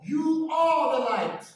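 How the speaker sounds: loud, high, slow declaration; plain emphatic statement. Retold or imitated. Preacher quotes the Lord saying it plain and simple, emphatic. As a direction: state it squarely, leaving no doubt. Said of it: "He says it plain and simple".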